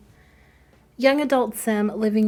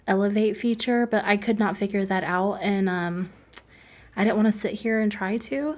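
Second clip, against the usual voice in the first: usual speaking voice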